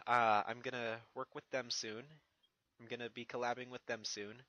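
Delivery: usual speaking voice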